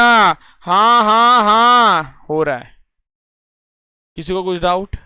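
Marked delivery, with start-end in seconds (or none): 0.0-2.2 emphatic drawn-out yes. Acted. Teacher answering the class's check question, emphatic drawn-out yes. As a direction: stretch each yes out loudly.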